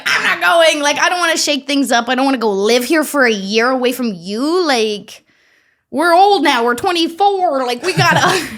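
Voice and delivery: high, loud, laughing mock protest; whiny protest. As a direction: stamp your foot, half laughing. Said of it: "I was like".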